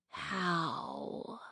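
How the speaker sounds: soft, creaky ancient dragon voice; eerie rasp. A narrator's reading. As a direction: rasp the word from far away. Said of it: "creaky and quiet and rough and eerie".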